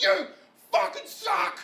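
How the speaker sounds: very high-pitched mock jeer; crowd jeering. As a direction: heckle him in a high, strained cry. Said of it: "everyone's like".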